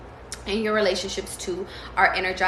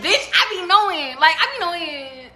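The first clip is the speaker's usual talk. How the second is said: higher, louder gloating quote; 10 semitones higher, 5 dB louder than usual. Retold or imitated. Reader telling Morgan how she gloats, high bragging quote. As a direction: brag that you knew it all along. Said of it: "I be like".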